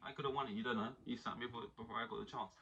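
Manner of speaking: quiet imitation of a sacked manager; Mourinho quote, subdued. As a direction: trail it out softly, as a mock grievance. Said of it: "he can walk away with that and be like".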